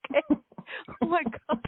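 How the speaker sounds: high-pitched words breaking out of laughter; giggly, overwhelmed. Real, not acted. Recalling shaking the commander's hand, giggly and overwhelmed. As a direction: squeak it out through giggles.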